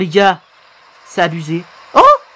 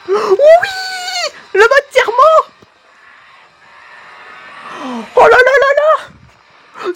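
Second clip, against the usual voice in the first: high-pitched, exultant cheering; ecstatic shouting; 13 semitones higher, slower than usual. Real, not acted. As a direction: yell out in sheer hype. Streamer exults at the game. (cris de joie surexcités)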